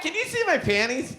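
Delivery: put-on high giggly drunk question; drunk wife. Wife on the floor asking the crowd, high tipsy voice. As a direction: ask it tipsy and coy.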